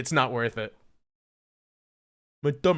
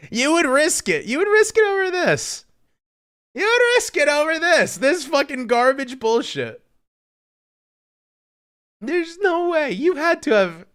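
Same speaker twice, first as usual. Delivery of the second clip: much higher and louder, disbelieving yelling; incredulous outburst; 12 semitones higher, 6 dB louder than usual. Real, not acted. Streamer shrieking at his gluten-sensitive friend over the pizza. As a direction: shriek it like this is an outrage.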